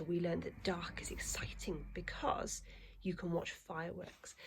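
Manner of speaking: whisper. Acted. Teacher whispers story intro.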